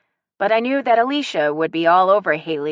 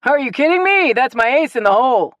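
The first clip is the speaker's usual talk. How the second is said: much higher, louder gleeful crow; laughing boast; 8 semitones higher, 8 dB louder than usual. A narrator's reading. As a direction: crow over his trump card. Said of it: "Dad laughed".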